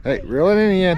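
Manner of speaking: calling out. Real, not acted. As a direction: call out across the water. Calling to kid fishing.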